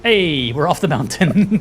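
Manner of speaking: normal talking voice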